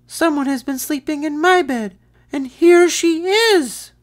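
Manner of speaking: put-on high squeaky baby bear. A narrator's reading. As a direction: squeak it out, excited.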